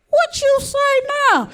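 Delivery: high, slow, incredulous; squeaky stunned question; shocked disbelief. Retold or imitated. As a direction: act floored by the idea. Sister's advice, shocked reply.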